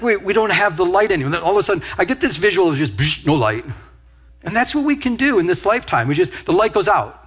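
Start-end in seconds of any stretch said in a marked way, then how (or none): none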